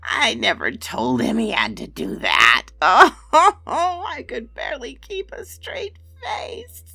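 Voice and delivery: high, loud amused crone; laughing witch. Acted. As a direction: giggle through it like a hag.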